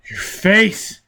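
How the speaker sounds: shocked cry, pitch jumping up; horrified exclamation. A narrator's reading. Prisoner staring at Foyle's face, horrified cry. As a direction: recoil in horror at the face. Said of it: "he exclaimed".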